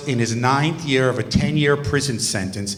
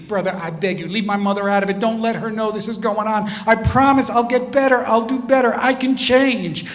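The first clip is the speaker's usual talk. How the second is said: high-pitched imitation of a pleading student; acted-out tearful begging; 8 semitones higher than usual. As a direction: plead it high, building in urgency. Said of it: "the tears started and the pleading".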